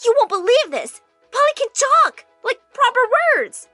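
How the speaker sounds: loud, very high exclamation; excited shout. A narrator's reading. Daughter shouting news as parents walk in, excited. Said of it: "and I shouted".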